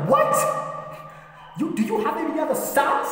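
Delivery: very high, incredulous demand; shocked wife. Acted. Jesse's wife confronts him over other sons, shocked. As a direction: squeal the question in outraged disbelief.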